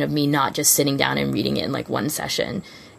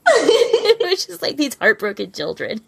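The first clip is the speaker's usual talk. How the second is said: high, slow laughing words; 11 semitones higher, slower than usual. Real, not acted. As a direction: laugh through it, slow and pitched up.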